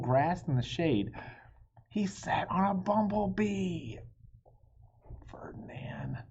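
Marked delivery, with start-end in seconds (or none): 5.3-6.2 breathy whispered name, dropped very soft; hushed whisper. Acted. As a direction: whisper his name in dismay. Reacts to bull sitting on bee.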